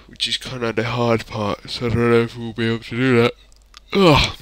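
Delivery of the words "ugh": loud high yawn; yawning sigh. Real, not acted. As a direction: let out a big yawn. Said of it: "Sorry, I'm yawning".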